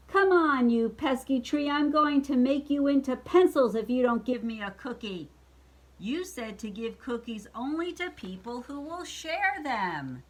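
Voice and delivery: high, loud scolding witch, easing in volume; storybook witch nagging and threatening; impatient witch. Acted.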